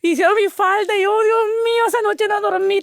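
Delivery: breathless retold joy; excited. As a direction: relive the thrill of the new skirt.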